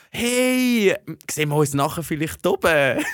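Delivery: high, loud hey easing down; breezy brush-off. Retold or imitated. Putting off the station woman, breezy brush-off. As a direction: act out a hurried friendly put-off.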